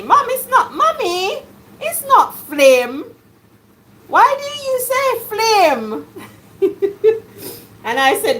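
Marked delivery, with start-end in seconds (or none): 0.0-6.1 high, mocking child voice, laughing; child correcting mom. Children correct their mom's pronunciation, high laughing child voice. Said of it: "they said to me". 6.6-7.2 laugh. Real, not acted. Laughing at child imitation.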